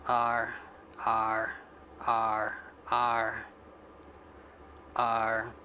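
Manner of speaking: low drawn-out pirate "Arr". Shown as a demonstration. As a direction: growl the R like a pirate. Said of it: "Yeah, I sound like a pirate".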